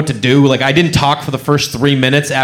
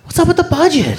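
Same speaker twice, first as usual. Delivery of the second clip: high odd-voiced question; mock casual business question; punchline; 11 semitones higher than usual. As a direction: toss it off in a strange high voice. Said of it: "I was like".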